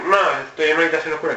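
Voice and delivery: quick, offhand delivery; sin pausas. Shown as a demonstration. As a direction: rattle it off in one go. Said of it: "lo dices más pausado". (dicho deprisa y sin pausa)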